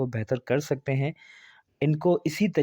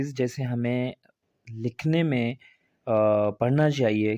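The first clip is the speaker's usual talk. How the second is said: usual speaking voice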